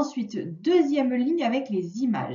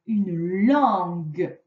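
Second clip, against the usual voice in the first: slow dictation; 4 semitones lower, much slower than usual. Shown as a demonstration.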